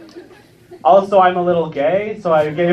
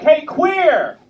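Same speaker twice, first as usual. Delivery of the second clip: high, hollered jeer with an encouraging message; mock bullying shout; 10 semitones higher than usual. Comedian mimics kids shouting at him, mock bullying shout. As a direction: yell it high like a playground heckler. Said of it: "shouting things like".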